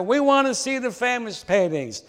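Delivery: high-pitched heckling call from the back; mild mock shout. A narrator's reading. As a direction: call out impatiently, wanting the good stuff. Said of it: "a voice in the back of the room shouted".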